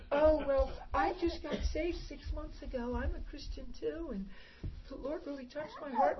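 a hint of a sincere girlfriend; sweet girlfriend voice. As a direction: lightly play the earnest young woman.

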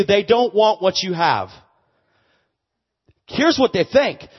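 loud, high preaching at full force; fiery preaching. Acted. Preacher testifies to his congregation, loud and fervent. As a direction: drive it home to the crowd.

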